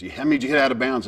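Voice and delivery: fast and loud; rapid pressing question. Retold or imitated. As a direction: rattle it off, demanding.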